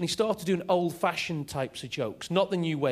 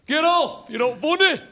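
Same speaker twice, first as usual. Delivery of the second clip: loud, higher barked heckles; heckler shouting; 9 semitones higher, 5 dB louder than usual. Retold or imitated. Heckler at the back shouting at the comic, loud. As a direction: yell it from the back of the room. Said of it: "balloon head ... at the back of the room going".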